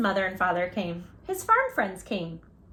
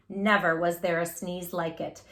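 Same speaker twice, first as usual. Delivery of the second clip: plain reading voice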